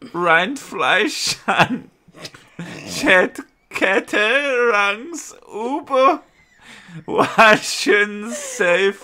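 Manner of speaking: slow, deliberate mock German; slowed-down German reading. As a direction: stretch out each German syllable carefully. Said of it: "do it in the softly spoken one".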